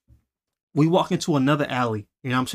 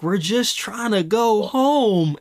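high, drawn-out imitated wail; mock crying; 7 semitones higher, much slower than usual. Scared kid pleading with the man, high slow mock-crying whine. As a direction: whine it slowly like a kid in tears. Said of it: "he literally starts crying".

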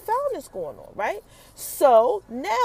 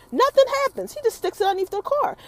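excited burst; 8 semitones higher, faster than usual. Real, not acted. Recapping the show for viewers, excited burst. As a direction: blurt it out with excitement.

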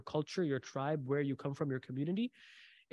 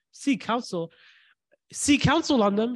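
rhetorical amazement; 6 semitones higher, 4 dB louder than usual. Acted.

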